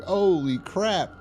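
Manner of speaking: high, drawn-out mock amazement; startled. Recapper voicing a man's shock at seeing someone, startled.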